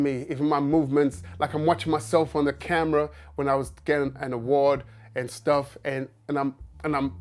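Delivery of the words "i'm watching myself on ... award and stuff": ordinary delivery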